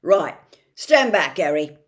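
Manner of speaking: put-on giant hurrying the boy along; gruff Hagrid. Hagrid warns Harry.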